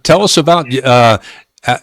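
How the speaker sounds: ordinary delivery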